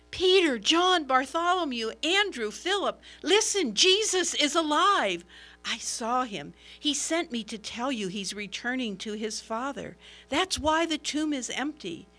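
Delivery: woman running to the disciples, shouting the news excitedly. Acted. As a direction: call out the good news to the disciples.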